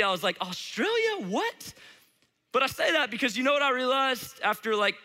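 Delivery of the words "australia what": high-pitched mock disbelief; incredulous. Substitute reacting to kids' wild guess, mock incredulity. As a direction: squeak it out, baffled. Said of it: "that's how I felt, honestly".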